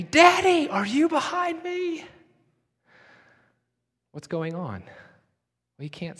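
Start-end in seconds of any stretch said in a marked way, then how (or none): 0.0-2.0 put-on high, loud and panicky little boy's call; panicky child. Boy who can't see his dad calling out in a high panic. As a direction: scream it like a lost child getting scared. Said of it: "his little voice will get louder and more panicky".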